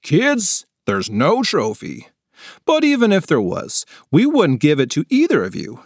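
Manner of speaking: lightly voiced worker, wry; amused adult. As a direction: let them down with a joke.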